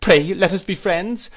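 high, slow, plaintive appeal; imploring. A narrator's reading. Mother begs to be friends, imploring. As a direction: beg for peace.

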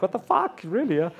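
high, loud disbelieving exclaim; surprised. Retold or imitated. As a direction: pitch jumps up in disbelief. Retold disbelief at the offer.

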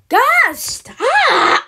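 high, loud two-burst yell; exasperated shout. Acted. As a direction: yell for them to stop. Begging the shooting to stop.

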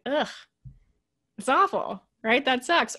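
ordinary delivery

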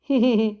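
a burst of loud laughter. A narrator's reading. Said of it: "నవ్వుతున్న ప్రభుని".

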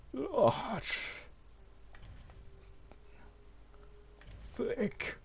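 very high comic squeak; high squeal. Acted. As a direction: squeak each word up high. High squeals reading sliders. (sehr hohes Quieken)

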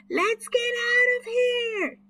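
high, loud yell; boy fleeing a fierce dinosaur, high yell. A narrator's reading. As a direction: scream at the others to flee. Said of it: "yelled Chip".

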